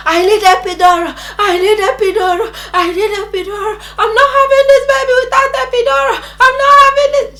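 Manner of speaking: high, wailing, insistent demand; screamed pleading. Retold or imitated. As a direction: act out desperate begging in labor. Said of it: "When I was still screaming that I needed".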